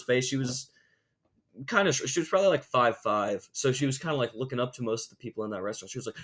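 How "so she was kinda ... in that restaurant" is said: normal talking voice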